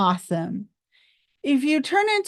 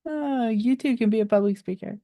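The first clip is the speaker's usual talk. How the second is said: ordinary talk, nothing marked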